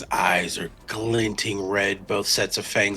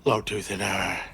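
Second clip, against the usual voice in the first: ordinary delivery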